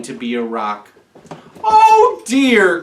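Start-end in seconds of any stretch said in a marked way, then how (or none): 1.6-2.8 a high, louder "Oh dear"; crying out. A narrator's reading. Young whale crying out in dismay at hiding failure. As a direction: cry out in dismay, pitched up. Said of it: "cried Kanani".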